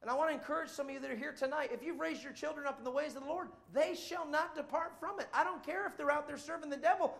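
ordinary talk, nothing marked

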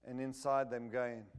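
ordinary talk, nothing marked

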